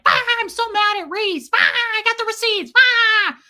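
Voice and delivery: high, loud shrieking rant; hammed-up screechy outburst; Marilyn. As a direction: scream each phrase like a hysterical imitation. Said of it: "how Marilyn comes on".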